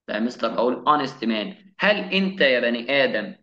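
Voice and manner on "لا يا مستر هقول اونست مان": ordinary talk, nothing marked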